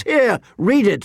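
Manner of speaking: high, drawn-out, eager urging; elated. A narrator's reading. Jon thrusts the letter into Tyrion's hands, elated. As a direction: urge it high and excited.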